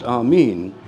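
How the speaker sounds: "Amin" as ah-MEEN; "Amin" with second syllable like "mean"; long-E pronunciation. Shown as a demonstration. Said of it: "it's always been pronounced".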